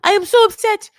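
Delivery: put-on very high, loud French-accented wail; hammed-up French accent, loudly complaining; Manon in bad French accent. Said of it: "My French accent is, you know, leaves a lot to be desired".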